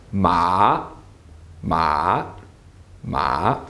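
MA dipping low, then rising; low dip-and-rise on MA; third tone. Shown as a demonstration. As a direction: let MA fall a bit and climb back up. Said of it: "you fall a bit and then you rise back up".

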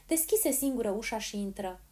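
ordinary delivery, nothing marked

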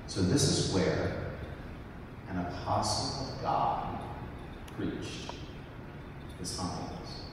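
whispered in awe, fading almost to nothing; awed whisper. Retold or imitated. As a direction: hush your voice as if standing somewhere holy. Awed thought at the apostle's pulpit.

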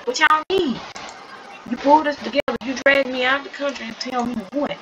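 high, heated demand; exasperated yell; yelling at her. Acted. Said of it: "she finna yell at her".

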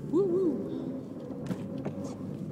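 airy high-pitched hooting; high "woo woo" hoot. Pretend. Imitated hooting noise.

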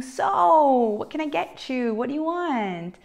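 high-pitched, cheerful offer to help; super happy, cheerful. Shown as a demonstration. Teacher translates a friendly allora for students, super happy and cheerful. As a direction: sing out the question with eager cheer. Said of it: "like super happy and cheerful".

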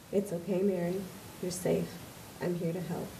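soft, low, reassuring words; gently calm a frightened patient; soothing caregiver. Acted. The nurse reassures Mary, soft and tender.

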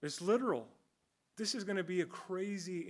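ordinary talk

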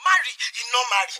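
rapid exasperated shout; nagging mother shouting at her son; fast scolding shout. Acted. Mother scolds son about marriage.

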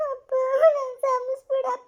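high, slow sobbing plea; tearful plea. Acted. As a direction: beg him through tears. Daughter begs father tearfully.

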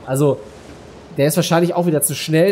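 ordinary talk

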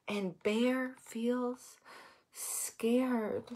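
very slow, soft storybook hush; softly, slowly, drawing out the suspense; hushed narration. A narrator's reading. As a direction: drop soft and stretch the words. Story refrain of scared bear.

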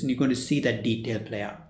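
usual speaking voice

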